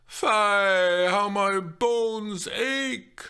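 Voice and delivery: very slow, loud groan. Acted. The nurse groaning about her bones, slow and loud. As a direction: ache through every word. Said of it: "the large lady groaned".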